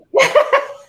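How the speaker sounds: short high burst of laughter. Real, not acted. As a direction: laugh at the letdown. Laughs at own story.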